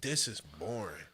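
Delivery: slow, flat bored quote. Retold or imitated. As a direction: drag it out bored. Repeating wife bored.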